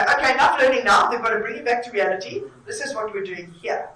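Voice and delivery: hammed-up boss snapping them back to work; firm call to order. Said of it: "and you coming in to say".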